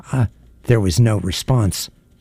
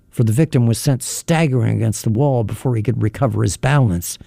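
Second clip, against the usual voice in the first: usual speaking voice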